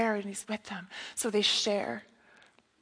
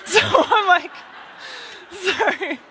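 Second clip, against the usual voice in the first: real laughter breaking into the words, higher pitch; laughing; 9 semitones higher than usual. Real, not acted. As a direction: crack up at your own joke while talking. Breaks up laughing at own joke.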